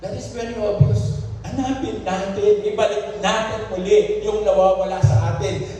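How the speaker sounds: high, urgent exhortation from the pulpit; impassioned preaching. Real, not acted. Preacher urging church to seek what was lost, impassioned preaching. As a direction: press the congregation in a sustained high voice.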